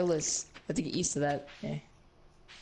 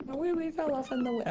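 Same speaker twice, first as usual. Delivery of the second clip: higher, faster silly repeat; high mocking echo; 8 semitones higher, faster than usual. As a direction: squeak it back mockingly.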